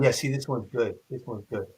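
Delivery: quiet approving mutter. Retold or imitated. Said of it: "Neil would nod".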